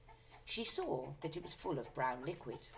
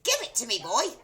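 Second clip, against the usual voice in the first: loud, high yell; Grandma yelling; 8 semitones higher, 10 dB louder than usual. A narrator's reading. Grandma yelling at George. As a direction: yell it at the boy. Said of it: "yelled Grandma".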